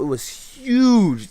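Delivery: slow, high, stretched huge; emphasis on huge. Retold or imitated. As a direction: drag out huge to sell the size. Stressing the apple's size.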